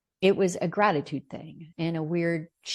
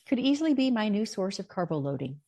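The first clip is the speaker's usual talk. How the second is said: mock-cheerful; 7 semitones higher than usual. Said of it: "and I was thinking".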